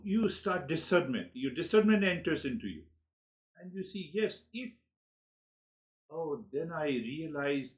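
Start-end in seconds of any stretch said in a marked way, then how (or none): none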